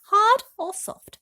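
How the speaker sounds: a bright hostess question, drawn out; Miss Spider. A narrator's reading. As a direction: spider asking James, high and slow. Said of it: "she said to James".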